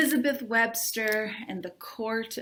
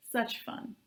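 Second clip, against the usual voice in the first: dry, whispered and much softer; sarcastic whisper; 8 dB quieter than usual. A narrator's reading. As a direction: mutter it with bitter irony. Narrator's sarcastic aside.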